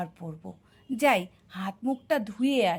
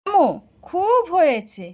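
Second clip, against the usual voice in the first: loud fed-up snap; enough, cut them both off; mother loudly scolding father and son; exasperated scolding; 5 semitones higher, 9 dB louder than usual. Acted.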